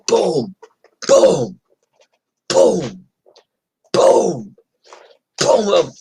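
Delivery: high booms falling in pitch; imitated blows. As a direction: land each boom as a separate hit, with pauses. Imitating beating with a bike.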